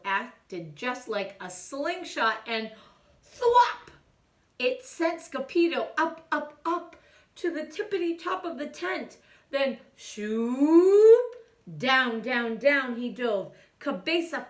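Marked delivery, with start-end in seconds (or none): 3.2-3.9 high loud thwop; slingshot thwop. Acted. Sound effect word. 10.0-11.4 loud swooping shoop; rising shoop. Acted. Sound effect rising.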